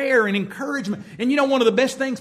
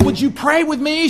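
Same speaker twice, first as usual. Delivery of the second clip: louder, higher, stressing pray; emphatic repeat; 5 semitones higher, 7 dB louder than usual. Retold or imitated. Preacher repeating her request with strong emphasis. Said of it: "She said".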